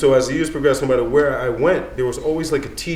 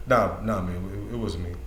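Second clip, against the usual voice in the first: quiet, low, mumbled denial; teen mumbling nah it wasn't me; 6 semitones lower, 8 dB quieter than usual. Retold or imitated. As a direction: mumble it like a caught kid. Young self denying again.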